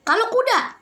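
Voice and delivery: usual speaking voice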